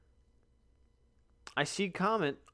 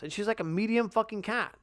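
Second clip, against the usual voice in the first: ordinary talk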